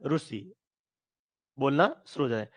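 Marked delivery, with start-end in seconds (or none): 0.1-0.4 ऋषि said as "ru-shi"; Gujarat pronunciation. Shown as a demonstration. Teacher shows what starts from Gujarat, ऋषि as "ru-shi". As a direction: give ऋषि an oo sound after the r. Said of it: "तो गुजरात से".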